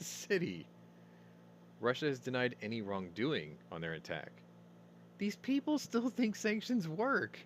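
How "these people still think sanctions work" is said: mocking scoff. Scoffing at sanctions news.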